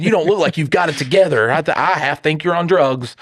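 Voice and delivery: put-on a kid sizing up a scruffy stranger; high mocking kid. Kid in line doubts the bearded man, high and mocking.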